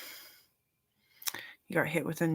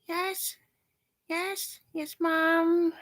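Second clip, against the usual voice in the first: put-on very high small child saying yes; mother voicing her toddler daughter; child's voice; 12 semitones higher, much slower than usual. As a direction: squeak like a toddler answering mom.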